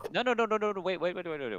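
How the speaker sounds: high, loud run of panicked protest; pleading, urgent interruption. Acted. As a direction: jump in fast to call off a fight. Hurriedly heading off a fight.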